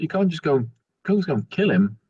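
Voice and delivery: ordinary talk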